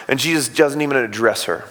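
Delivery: ordinary delivery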